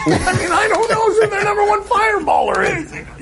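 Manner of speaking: high, amused disbelief; laughing. Real, not acted. Host laughing at co-host's fireballer claim, high amused. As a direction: laugh while protesting you don't know.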